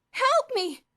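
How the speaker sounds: high, loud plea; pleading. A narrator's reading. Elmer begging the boys to save his ants, pleading. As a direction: beg for help. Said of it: "Elmer pleaded".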